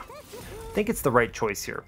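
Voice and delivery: usual speaking voice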